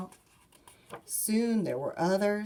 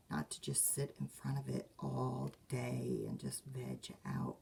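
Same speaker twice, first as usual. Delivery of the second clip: whispered aside; 5 semitones lower, 8 dB quieter than usual. Real, not acted. Aside to children.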